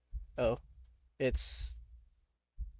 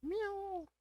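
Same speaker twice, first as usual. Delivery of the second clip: high, soft playful meow; cute meow; 12 dB quieter than usual. Pretend. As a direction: mew like a tiny kitten. Imitating the cat.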